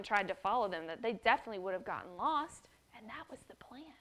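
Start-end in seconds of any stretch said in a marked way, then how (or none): 2.9-3.9 whispered aside; whispered storyteller aside; secret whisper. A narrator's reading. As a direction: let the kids in on a secret.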